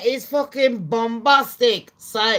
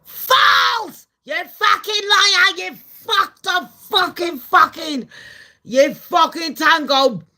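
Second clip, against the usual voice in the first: much higher, shouted accusation; mock furious Musk; 11 semitones higher, 7 dB louder, slower than usual. Musk shouting back at Trump, high furious yell. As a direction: scream it like a betrayed rival. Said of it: "And Musk was like".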